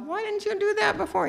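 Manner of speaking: high, exasperated mock complaint; frustrated retort. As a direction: snap it like a fed-up wife. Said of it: "That was my attitude".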